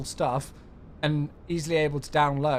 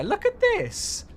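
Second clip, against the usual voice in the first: excited; 3 dB louder, slower than usual. Real, not acted. Showing off favourite garden area.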